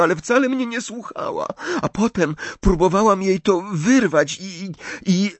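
high, tearful, halting lament; despairing. A narrator's reading. Leni blames herself to Hermione, despairing. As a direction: wail it, breaking off at the end. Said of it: "jęknęła głośno z rozpaczy".

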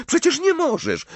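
high-pitched shocked protest; indignant. A narrator's reading. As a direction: object in an outraged girl's voice. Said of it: "oburzyła się Hermiona".

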